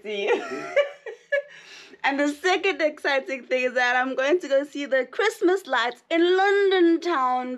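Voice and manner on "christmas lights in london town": slow, high, stretched out; drawn-out flourish. Pretend. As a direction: stretch the words like a jingle.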